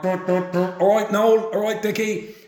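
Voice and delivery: high-pitched hail to passing villagers; calling greetings. Retold or imitated. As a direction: toss out hellos to people going by. Dickie greeting passers-by mid-moan.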